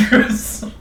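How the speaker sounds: put-on loud joke French accent; hammed-up French accent on one word; mock French accent. Game master echoes player's joke, loud mock French accent.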